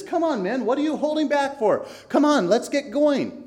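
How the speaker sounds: high, loud, bossy urging; the guide impatiently pushing the men onward; cocky Vain Confidence. Acted. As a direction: hurry the men along. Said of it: "and he says".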